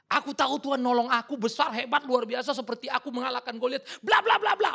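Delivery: a hint of a confident David, loud; voicing David. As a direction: act out David's proud memory. Voicing David's boast of past help.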